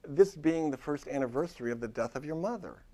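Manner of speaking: usual speaking voice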